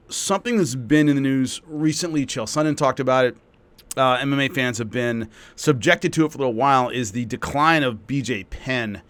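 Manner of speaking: usual speaking voice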